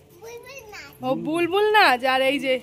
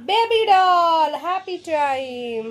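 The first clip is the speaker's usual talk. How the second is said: slow sing-song; 3 dB louder, much slower than usual. Acted. Announcing doll name.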